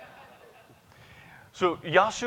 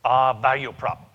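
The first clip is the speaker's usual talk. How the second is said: put-on light Japanese accent; 6 dB louder than usual. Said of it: "now I'm gonna stop with his voice".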